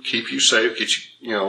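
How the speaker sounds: ordinary talk, nothing marked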